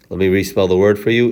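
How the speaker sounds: ordinary talk, nothing marked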